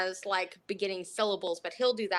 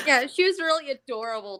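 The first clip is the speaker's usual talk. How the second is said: ordinary talk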